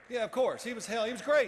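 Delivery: excited. Real, not acted. Enthusiastic praise of Lawrence.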